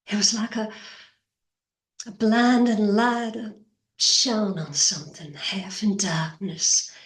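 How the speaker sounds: wistful Southern belle, halting with long pauses; haunted confession, drifting down into breathy near-whispers; Blanche Dubois. Acted. Auditioner as Blanche recalling her lost young love, haunted and halting.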